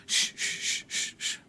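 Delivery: soft shushes into a near-whispered word; soothing hush. Acted. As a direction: comfort gently, hushing as you go. God's consoling voice, shushing. (consuelo suave, casi susurrado)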